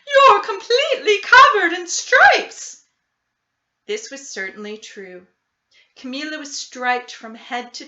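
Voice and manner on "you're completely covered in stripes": high, loud horrified shriek of a frightened mother; shrieking cry. Acted. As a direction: scream it out in shock at the stripes. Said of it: "she cried".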